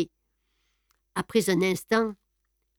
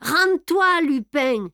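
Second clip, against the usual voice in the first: much higher, loud shouted order; yelled; 6 semitones higher, 4 dB louder, slower than usual. A narrator's reading. Ganimard yells at Lupin through the broken door, full shout. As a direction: bellow the surrender demand at the door. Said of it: "hurla Ganimard".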